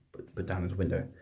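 normal talking voice

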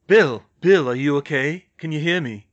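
loud, alarmed check on a friend; urgent calling. Acted. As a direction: call out to rouse him. Harry urgently checks on Bill.

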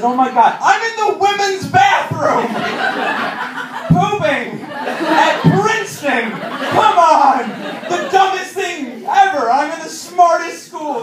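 high, loud, slow shout of horror; panicked shout. Retold or imitated. Realising he's in the women's bathroom at Princeton, shouting high and loud. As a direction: yell it in mock panic at your own mistake. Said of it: "I realized".

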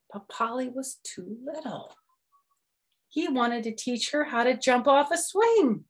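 ordinary delivery

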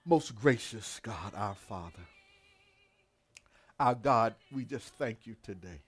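low, hushed prayer with long pauses; reverent prayer. Real, not acted. Preacher addressing God our Father, low reverent prayer. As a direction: settle down softly into earnest prayer.

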